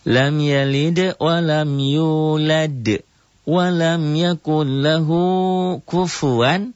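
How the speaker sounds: slow, high melodic tartil chant; Quran recitation. Shown as a demonstration. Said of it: "teacher recites Quran verse". (lantunan tartil pelan)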